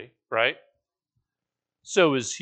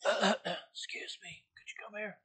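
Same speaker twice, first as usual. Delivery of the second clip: tiny, timid whisper for attention; barely audible, slow, polite request; too soft; 6 semitones higher, 11 dB quieter than usual. Acted. Wanting someone's attention in a crowd, far too softly. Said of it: "It's not soft".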